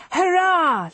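put-on high-pitched, drawn-out boyish cheer; boy's shout. A narrator's reading. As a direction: cry it out like an excited boy, not at full volume. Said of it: "and shouts".

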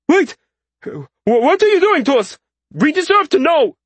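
high, loud soldier's protest; panicked shout. A narrator's reading. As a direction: cry out in alarm, demanding an answer. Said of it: "shouted one of the soldiers".

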